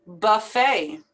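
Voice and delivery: "Buffet" as "buh-FAY", silent T; A-sound ending. Shown as a demonstration. Teacher modelling the AY ending, carefully pronounced. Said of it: "ending with an A sound".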